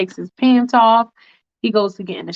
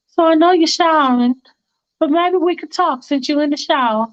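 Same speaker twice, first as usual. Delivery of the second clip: put-on much higher girlfriend voice, coaxing; Faith's voice; 7 semitones higher than usual. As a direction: go high and wheedling for Faith. Faith barges into bathroom.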